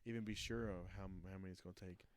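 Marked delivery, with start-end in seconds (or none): none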